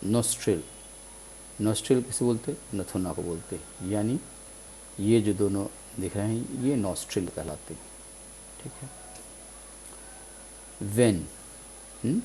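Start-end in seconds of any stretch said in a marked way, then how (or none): none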